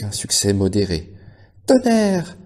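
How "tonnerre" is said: high piercing call; desperate cry. A narrator's reading. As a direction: scream his name after the van. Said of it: "cria-t-elle".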